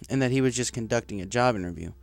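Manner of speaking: plain reading voice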